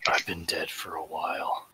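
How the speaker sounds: soft, unvoiced confession; eerie whisper. Lucius whispering to the siren after unmasking. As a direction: whisper it like a grim secret. Lucius reveals his eyes.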